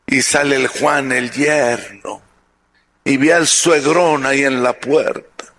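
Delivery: ordinary delivery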